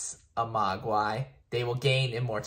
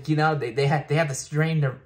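normal talking voice

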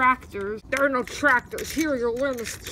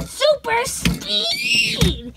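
much higher, very slow drawn-out call; hero call; 12 semitones higher, much slower than usual. Acted. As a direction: announce the power like a cartoon hero. Using the Sky Chi ability.

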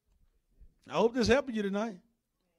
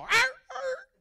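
short yelping snarls; imitated dog snarl. Dog answering sudden braveness, short imitated snarls. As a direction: snap back like a startled dog.

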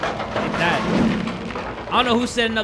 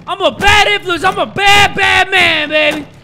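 high, loud, swaggering shout; hyped boast; 13 semitones higher, 10 dB louder than usual. Real, not acted. Player boasting mid-game, hyped shout. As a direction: shout it like you're pumped up.

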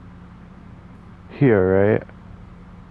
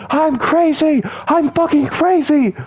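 crazy voice; slower than usual. Pretend. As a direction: shriek it like a cartoon maniac. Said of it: "I love talking like that".

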